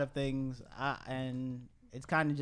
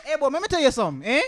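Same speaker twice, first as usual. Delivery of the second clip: put-on Jamaican accent; 10 semitones higher, 11 dB louder, faster than usual. Joins in Jamaican impression.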